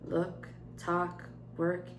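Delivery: usual speaking voice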